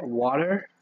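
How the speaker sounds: questioning try at American "water"; imitated American pronunciation of "water"; American accent. Shown as a demonstration. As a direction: say "water" as Americans do, checking it's right. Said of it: "Is that how you guys say it?".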